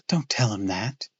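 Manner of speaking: usual speaking voice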